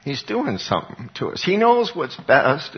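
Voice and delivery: usual speaking voice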